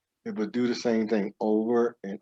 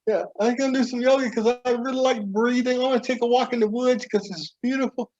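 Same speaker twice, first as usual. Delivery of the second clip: higher self; 12 semitones higher than usual. Acted.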